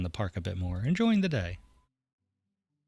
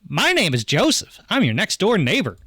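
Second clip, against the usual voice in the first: put-on Southern accent; 9 semitones higher, 8 dB louder than usual. Neighbor introducing himself next door, in a Southern accent. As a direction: introduce yourself as a big-voiced Southern neighbor. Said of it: "I don't know why everyone's Southern".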